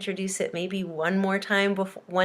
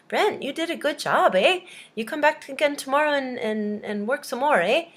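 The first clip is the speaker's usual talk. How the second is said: higher-pitched Canadian boss, pleased; 6 semitones higher than usual. Acted. Canadian boss praising Brent's day, hammed-up Canadian. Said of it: "the Canadian says to him".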